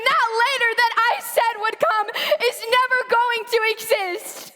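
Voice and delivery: high, anguished crying out; tearful anguish to God; tragic heroine sorrow. Acted.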